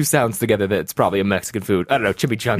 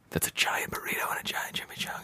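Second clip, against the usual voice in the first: whispered aside as the food arrives; very soft, breathy whisper; hushed aside; 14 dB quieter than usual. Real, not acted. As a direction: whisper it under your breath.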